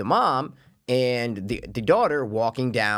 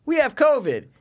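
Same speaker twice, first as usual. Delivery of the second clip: put-on kid voice; 13 semitones higher, 3 dB louder than usual. Kids say they had covid.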